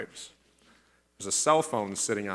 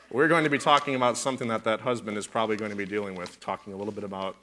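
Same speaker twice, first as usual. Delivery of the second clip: ordinary delivery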